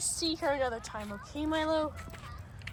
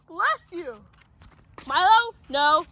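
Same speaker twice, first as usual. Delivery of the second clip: ordinary talk, nothing marked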